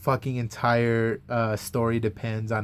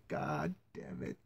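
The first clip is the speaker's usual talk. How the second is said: much quieter, low and slow; muttered curse; 10 semitones lower, 16 dB quieter, slower than usual. Retold or imitated. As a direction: grumble it under your breath. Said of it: "It's like".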